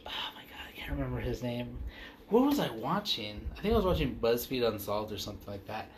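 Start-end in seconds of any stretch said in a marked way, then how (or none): 0.1-1.8 whispered, fast aside. Real, not acted. Trying to recall a name, whispered. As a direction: whisper it to yourself.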